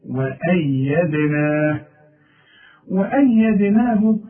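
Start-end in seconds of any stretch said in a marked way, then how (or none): 0.1-1.8 وأيدنا drawn very slowly and lightly; slow light recitation. Shown as a demonstration. Teacher showing the light vowels in recitation. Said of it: "فوق النون ألف أهي".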